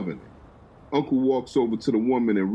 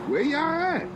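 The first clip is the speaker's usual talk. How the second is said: Big Will asking. Acted.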